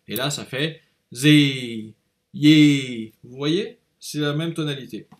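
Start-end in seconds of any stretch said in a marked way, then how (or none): 0.1-3.1 zi and yi said slowly with a falling, let-down tone; slow falling zi, then yi; disappointed tone. Shown as a demonstration. Teacher applies the disappointed tone to zi and yi. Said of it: "faites comme si vous étiez déçu".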